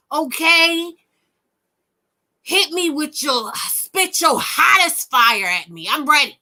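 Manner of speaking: mock-eager defiance; sarcastic dare. Real, not acted. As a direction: taunt them to bring it on.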